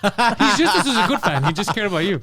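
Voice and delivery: loud laughing through the words. Real, not acted. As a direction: crack up while talking. Laughing at the story.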